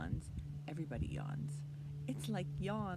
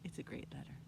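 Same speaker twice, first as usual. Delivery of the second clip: whispering; 6 semitones lower, 7 dB quieter, faster than usual. Acted. Aside to children.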